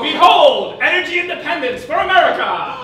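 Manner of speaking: loud, high grand announcement; grand proclamation. Acted. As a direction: proclaim it like a herald. Elf grandly presents tortoise.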